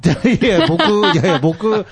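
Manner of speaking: laughing, high-pitched mock complaint; laughing protest. Real, not acted. Host laughs at being forgotten. (笑いながら高い声で抗議)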